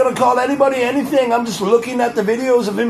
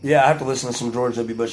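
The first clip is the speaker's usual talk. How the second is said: ordinary talk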